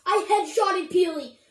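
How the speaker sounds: loud, punched-out brag; excited callout. Real, not acted. Gamer bragging about headshotting Peely, excited callout. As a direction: shout the kill to your friends.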